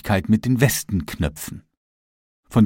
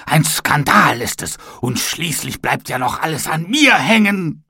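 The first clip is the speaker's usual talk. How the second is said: angry oven; 6 semitones higher than usual. A narrator's reading. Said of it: "schrie er wütend".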